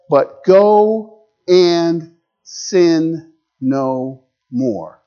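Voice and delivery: very slow, every word drawn out; slow command. Retold or imitated. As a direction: lay down each word gently and slowly. Jesus's final gentle command.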